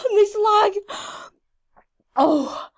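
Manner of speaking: loud, high overexcited cry; real outburst, still overwhelmed; freaking out. Real, not acted. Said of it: "I am actually freaking out".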